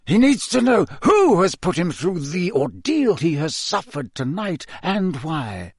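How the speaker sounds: high, emphatic explanation; insist, leaning on the key words; insistent old headmaster. Acted. Dumbledore explains to McGonagall why Harry stays, insistent.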